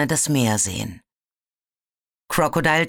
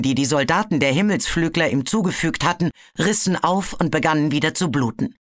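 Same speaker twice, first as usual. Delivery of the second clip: usual speaking voice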